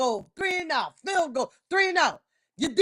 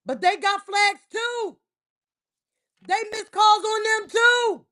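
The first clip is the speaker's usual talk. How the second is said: raised, slow heated shout; 6 semitones higher, 4 dB louder than usual. Real, not acted. Fan shouting back at a co-host about missed calls, loud and slow. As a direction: shout the point at the room.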